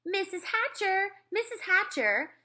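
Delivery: put-on high, eager girl's call. A narrator's reading. Girl calling to her friend's mother, high eager call. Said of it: "Sheila called".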